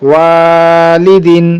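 "ওয়ালিদিন" with long held "ওয়া", short endings; stretched reading. Shown as a demonstration. Teacher joining syllables for students, "ওয়া" drawn out. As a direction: sustain the first vowel of "ওয়ালিদিন". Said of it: "টানা পড়ে চলে যাবো".

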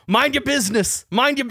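mock indignant comeback; retold angry retort. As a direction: act out an irritated, repeated retort. Acted angry retort in lobby quarrel.

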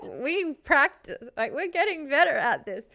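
retold cry of exasperation; exasperated protest. Streamer recalls her heartbreak at chat's delay, exasperated. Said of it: "I was like".

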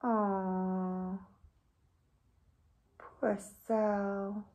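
plain reading voice

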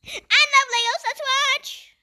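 high, loud burst of delight; excited exclamation. Real, not acted. Player eager to watch Legos, excited. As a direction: squeal it with eager enthusiasm.

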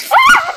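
sudden high, loud startled scream. Real, not acted. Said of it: "nu urlu așa ca o fetiță". (țipăt speriat, ascuțit, de fetiță)

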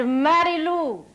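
slow, imitated Hungarian accent. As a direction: play the coach promising gold in a Hungarian accent. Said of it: "deep Hungarian accent".

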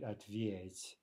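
Ответь stressed on ве; careful model of Ответь, stem syllable stressed. Shown as a demonstration. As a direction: repeat Ответь clearly with stress on ве. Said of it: "with the stem stress".

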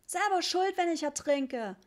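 put-on high, loud childish yell. A narrator's reading. Richie running off to the water, yelling. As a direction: shout it in a child's huff. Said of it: "schrie Richie".

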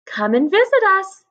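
put-on high, loud call after leaving children; shouted farewell. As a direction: call out across a distance. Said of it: "they shouted".

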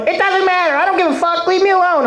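high-pitched imitation of an indignant mom; defiant retiree mother. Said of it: "in the back thing going".

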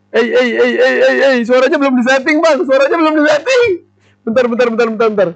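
high, hurried, flustered chatter; flustered high panic. Real, not acted. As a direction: rush it, panicking and overwhelmed. Panicking, sound not set. (panik dan heboh, suara tinggi)

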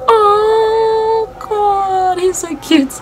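high, gushing swoon; swooning fan. Retold or imitated. Fan swooning over Tito's voice, high and gushing. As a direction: melt over his cute accent. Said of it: "I'm like".

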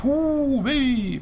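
high, long spooky "huu weh"; ghostly wail. Pretend. Spooky noise while reading item.

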